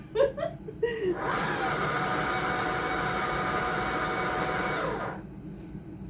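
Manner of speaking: long high wheezing laugh; helpless laughter. Real, not acted. Cook laughing at her jumping blender, wheezing. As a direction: laugh till the breath runs out.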